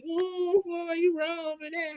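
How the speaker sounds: high, slow mock-scolding girl voice; teasing girl. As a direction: tease him like the girl did. Girl reacting to his tackle.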